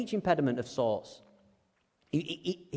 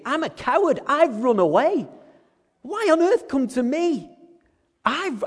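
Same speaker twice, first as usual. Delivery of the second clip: much higher, louder incredulous protest; exasperated Moses; 9 semitones higher, 4 dB louder than usual. Retold or imitated. Moses protesting to God he is a coward, high and incredulous. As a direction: protest in disbelief. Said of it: "put ourselves in Moses' shoes".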